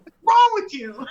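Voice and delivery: mock-scold yourself while cracking up; laughing scold. Retelling yelling at herself while editing, laughing. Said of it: "I'm talking to myself like I know me".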